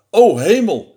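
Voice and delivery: loud, startled exclamation; storybook alarm; alarmed owl. Acted. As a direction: cry out at a frightful sight.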